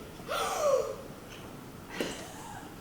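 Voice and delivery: huge sudden gasp of held breath; exaggerated gasp. Pupil forbidden to breathe, exaggerated gasp. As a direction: suck in air in a big mock gasp.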